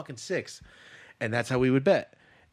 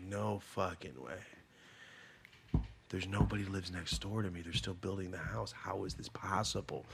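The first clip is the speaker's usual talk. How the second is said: low, soft muttered incredulity; hushed disbelief; 6 semitones lower, 9 dB quieter than usual. Retold or imitated. Alone hearing laughter outside, hushed disbelief. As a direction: think aloud under your breath, baffled.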